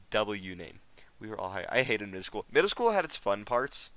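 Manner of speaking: usual speaking voice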